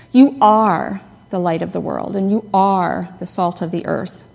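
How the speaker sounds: "are" punched in both halves; stress on ARE. Shown as a demonstration. Preacher reading Jesus' words as identity, stress on "are". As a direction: lean on "are" each time, then let it fall. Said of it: "talk about the second sentence".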